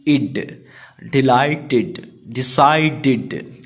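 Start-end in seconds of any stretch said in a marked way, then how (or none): none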